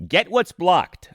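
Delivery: ordinary talk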